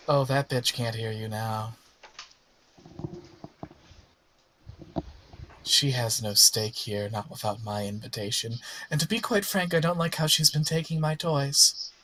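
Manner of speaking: lightly voiced smug villain; understated, possessive menace; taunting villain. Villain dismissing the god who took her toys, lightly voiced taunt.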